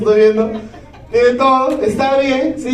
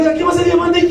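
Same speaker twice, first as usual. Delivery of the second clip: excited shout; 7 semitones higher, faster than usual. Retold or imitated. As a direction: call it out to the crowd. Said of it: "Yo gritaba". (grito emocionado y agudo)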